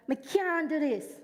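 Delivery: lightly voiced Jamaican patois, slowed; a hint of a Jamaican child's patois. Pastor quoting her childhood self in light Jamaican patois. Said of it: "this is in patois".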